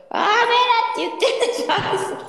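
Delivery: high, playful scolding imitation; mock angry scold. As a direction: imitate a friend scolding the chat. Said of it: "アイス投げられたら怒ってるからね".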